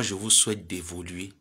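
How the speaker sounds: usual speaking voice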